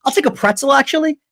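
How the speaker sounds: cheeky uncle. Retold or imitated. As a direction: casually call his bluff. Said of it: "my one uncle says".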